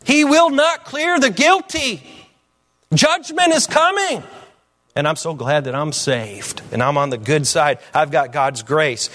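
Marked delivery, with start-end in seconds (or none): 0.0-4.2 high, ringing pulpit declaration; preaching at full force. Real, not acted. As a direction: drive each phrase home, building higher and higher.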